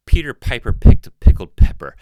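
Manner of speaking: popping P sounds right on the capsule; close-mic plosives. Shown as a demonstration. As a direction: hit every P hard into the mic. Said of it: "It's horrible".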